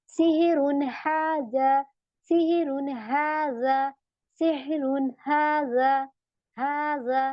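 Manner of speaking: slow tajweed recitation, repeated. Shown as a demonstration. Teacher modelling the verse for students, slow and chanted. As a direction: chant सिहरुन हाजा slowly, with a clear n before हा. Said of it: "मैंने ऐसे पढ़ दिया".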